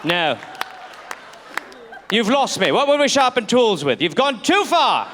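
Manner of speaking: indignant mock British accent; British gentleman. Colonist rejecting his friend's drastic idea, indignant British accent. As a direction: refuse like an affronted Englishman, slowing at the end.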